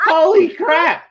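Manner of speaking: high, loud cry of disbelief; stunned shout. Real, not acted. Player shouting at the big hit, amazed. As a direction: blurt it out, floored by the hit.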